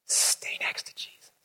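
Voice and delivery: hushed, unvoiced whisper. Shown as a demonstration. Preacher urging the congregation closely, whispered. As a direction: breathe it like a secret.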